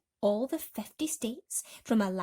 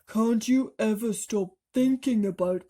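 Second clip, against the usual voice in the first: exasperated earthworm; 2 semitones higher, 7 dB louder than usual. A narrator's reading. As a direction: drag it out in exasperation. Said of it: "said the earthworm".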